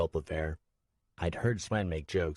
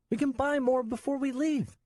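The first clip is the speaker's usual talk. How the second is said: put-on tearful child. Nico dropped off alone, high tearful child. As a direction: hold back tears as a scared boy. Boy bargaining.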